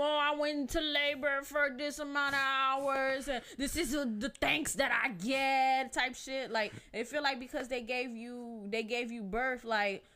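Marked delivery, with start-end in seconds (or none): 0.0-5.9 high, loud mom guilt trip; guilt-tripping mom. Retold or imitated. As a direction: whine like a martyred mother. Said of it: "my mom talking about some".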